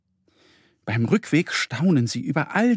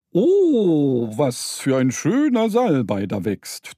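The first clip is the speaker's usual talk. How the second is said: booming voice; slower than usual. A narrator's reading. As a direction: boom out your delight at the sage. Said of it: "tönte eine kräftige Stimme".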